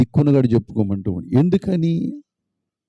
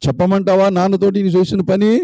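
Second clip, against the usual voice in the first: threatening sister; 9 semitones higher than usual. Acted. Sister threatening to tell father, high and taunting. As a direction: dangle the secret over him.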